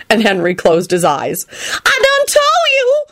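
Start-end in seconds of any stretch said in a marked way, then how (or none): 1.5-3.0 very high, drawn-out lament; piercing, stretched complaint; desperate wail. A narrator's reading. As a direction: wail it in despair. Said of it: "Shalonda wailed desperately".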